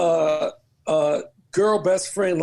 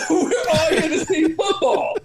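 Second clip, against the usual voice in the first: high refusal dissolving into laughter; laughing refusal; 10 semitones higher, 3 dB louder than usual. Retold or imitated. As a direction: laugh your way through turning it down. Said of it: "I'm like".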